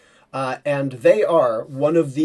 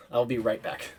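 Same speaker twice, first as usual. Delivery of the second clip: ordinary delivery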